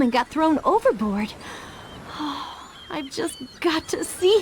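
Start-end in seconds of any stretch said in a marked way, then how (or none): 1.9-2.5 soft lovesick sigh; dreamy sigh. Acted. Ariel longing to see Eric again, sighing softly. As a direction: sigh for the prince she misses.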